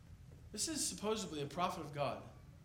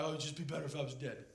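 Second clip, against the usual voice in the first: mock whine, low and softer, fast; whiny; 4 semitones lower, 4 dB quieter, faster than usual. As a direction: whine it like a sulking prophet. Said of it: "Jonah whines about later".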